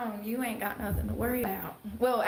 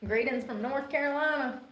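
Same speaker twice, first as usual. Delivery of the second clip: put-on thick Southern accent; 5 semitones higher than usual. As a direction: lay the North Carolina twang on thick. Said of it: "thinks we all talk like that".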